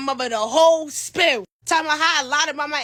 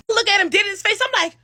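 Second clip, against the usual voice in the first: normal talking voice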